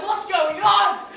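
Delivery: very high, loud, slow squeal of confusion; mock bewildered yelp. Son reacting to dad slamming table, mock panicked squeal. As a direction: play the baffled kid, squeaking in panic. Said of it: "I'm like".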